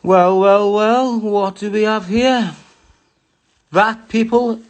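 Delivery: slow, drawn-out sly reveal; mock theatrical. Unveiling a photo to his viewers, slow and mock theatrical. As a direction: savour it like a villain spotting prey.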